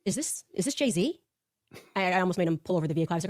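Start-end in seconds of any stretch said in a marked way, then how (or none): 0.0-1.2 slow, higher, clueless mock voice; puzzled Charlie. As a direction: play him baffled by the song. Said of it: "Charlie goes".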